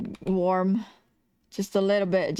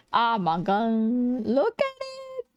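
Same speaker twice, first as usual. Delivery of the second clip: gushing; 2 semitones higher, much slower than usual. Real, not acted. Streamer admiring their own drawing, gushing squeal. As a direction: stretch each word and soar up at the end.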